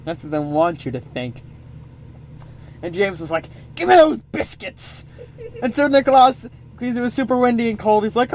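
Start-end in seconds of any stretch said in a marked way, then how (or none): 3.8-5.1 much higher mock-greedy shout; greedy demand. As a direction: demand the biscuits like a spoiled glutton. James demands biscuits.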